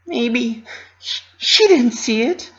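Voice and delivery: high, hesitant hopeful voice; hopeful Pinkie. Acted. Pinky hoping the filly missed it, high hesitant voice. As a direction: reach for hope in a small high voice.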